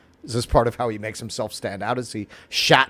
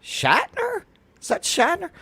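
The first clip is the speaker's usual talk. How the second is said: retold surprise. Retold surprised whisper to neighbour.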